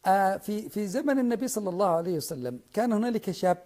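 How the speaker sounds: rising passionate oratory; fiery preaching. Real, not acted. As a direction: preach it with full conviction. Impassioned preaching to online audience.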